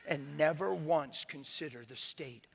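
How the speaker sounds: slow. Retold or imitated. The Lord's rebuke, slowed.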